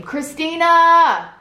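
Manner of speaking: long drawn-out high call across the yard; stretched-out shouted name. Retold or imitated. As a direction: call her name from next door. Stepfather calling her name outside.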